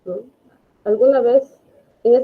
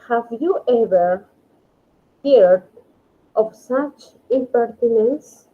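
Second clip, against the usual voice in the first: slow model reading; 3 semitones higher, slower than usual. Shown as a demonstration. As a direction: read the English sentence slowly and deliberately. (lectura lenta del ejemplo en inglés)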